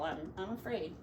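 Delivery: plain reading voice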